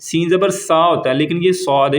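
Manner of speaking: ordinary delivery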